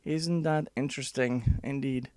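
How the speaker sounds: ordinary delivery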